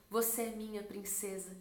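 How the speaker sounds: soft, low, tender voice; tender declaration. Retold or imitated. God to His daughter in the fitting room, tender declaration. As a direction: speak it quietly, as a loving father would.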